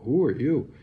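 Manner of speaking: high, loud frightened shout; alarmed shout. Retold or imitated. Patient shouting at doctor bursting in at six am, loud and high. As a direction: yell it at the stranger in your room.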